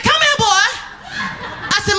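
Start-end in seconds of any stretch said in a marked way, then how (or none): 0.0-0.7 stern mom. Retold or imitated. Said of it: "She said".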